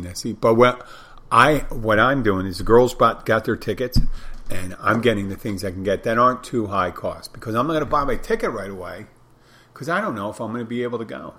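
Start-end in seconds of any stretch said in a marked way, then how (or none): none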